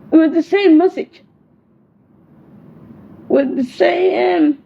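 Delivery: "same message" pitched high, with SAME drawn out; insistent emphasis. Real, not acted. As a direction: hammer home "the same message", stretching SAME high. Insistent repeated pleading about the message.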